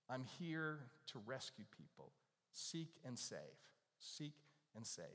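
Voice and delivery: hushed, earnest mission statement; soft earnest vow. Retold or imitated. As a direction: speak it like a quiet promise. Voicing Jesus's mission, softly.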